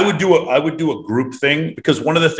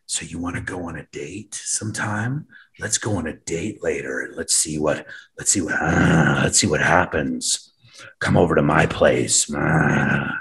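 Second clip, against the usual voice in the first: creepy guy; 7 semitones lower, 6 dB quieter, slower than usual. Retold or imitated. Mock creepy date invite.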